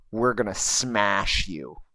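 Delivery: slow, low, deliberate threat; slow menacing threat. Retold or imitated. Friend refusing the goblin, slow and mildly menacing. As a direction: lean on each word, lightly menacing. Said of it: "Very good articulation".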